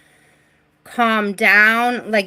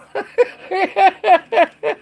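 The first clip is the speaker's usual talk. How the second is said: burst of laughter; 10 semitones higher, 4 dB louder than usual. Real, not acted. Laughs at own joke.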